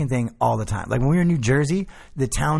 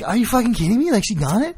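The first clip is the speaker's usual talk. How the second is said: retold disbelief; 8 semitones higher, 3 dB louder than usual. Retelling shock at GPS.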